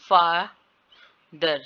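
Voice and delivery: "father" split in two, long first syllable. Shown as a demonstration. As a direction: pause inside "father" before its second syllable. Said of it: "ये second syllable है". ("father" को दो syllable में तोड़कर)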